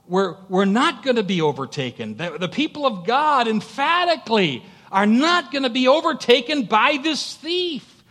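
high, loud, rising pulpit cry; emphatic preacher at full voice; full-force preaching. Acted. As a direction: hammer the promise home to the congregation.

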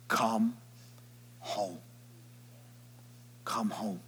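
soft, gentle call; tender. Acted. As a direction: invite them home softly. God's soft invitation.

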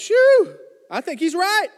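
a high, loud "Shoo!" then a wry aside; startled whistle. Retold or imitated. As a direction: react as if the verdict knocked you back. Preacher voices his own reaction.

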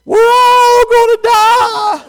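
loud, very high, long-drawn shriek; panicked passenger screaming the plane down; imitated scream. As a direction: scream in total panic, stretching every word. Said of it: "And then she screamed again".

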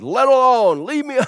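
high, loud demon's protest; crying out. Retold or imitated. Unclean spirit begs to be left, crying out high. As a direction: cry it out as the spirit. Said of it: "cried out, saying".

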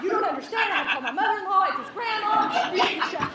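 high-pitched comic pleading; hammed-up desperate justification; frantic protest. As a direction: protest loudly, dragging out the last words. Acted protest to 911 operator.

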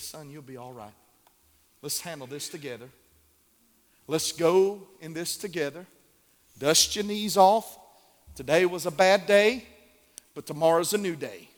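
soft, low comforting voice; tender reassurance. Retold or imitated. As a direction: speak like a gentle savior to a child.

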